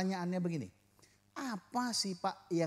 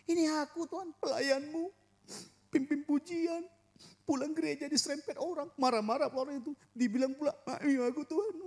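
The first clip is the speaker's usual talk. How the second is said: much higher, aggrieved whine; whiny servant complaint; 10 semitones higher than usual. Retold or imitated. Church worship leader complaining to God after being grazed, high aggrieved whine. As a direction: sound wronged and self-pitying. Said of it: "Kalau Mas Mul begini".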